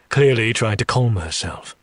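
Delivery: ordinary delivery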